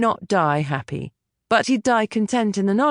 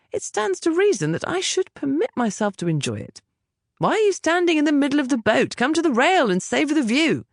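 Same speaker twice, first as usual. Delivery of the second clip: high, easygoing gentleman friend, teasing; lightly voiced Finn, amused; 8 semitones higher than usual. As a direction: raise the pitch a little and tease him gently. Finn's voice in narration.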